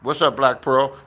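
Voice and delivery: ordinary talk, nothing marked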